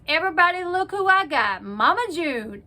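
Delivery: loud, high retold holler; mock announcing call. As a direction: call it down the hall, showing off a catch. Sheriff announcing prisoner to the cells.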